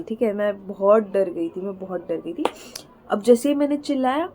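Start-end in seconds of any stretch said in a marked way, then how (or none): 1.6-2.4 much softer repeat, nearly under the breath; quiet. Real, not acted. Telling viewers how scared she was, in a much softer repeat. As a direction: let the words sink away softly. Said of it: "मैं बोल नहीं सकती".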